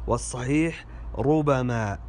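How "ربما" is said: "ربما" as rubama; single ba. Shown as a demonstration. Teacher gives the correction, "ربما" said rubama. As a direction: say "ربما" with one light b and open a. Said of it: "الباء مفتوحة وليست مشددة".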